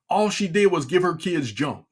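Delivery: ordinary delivery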